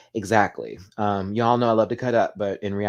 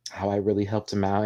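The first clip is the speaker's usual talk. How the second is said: ordinary delivery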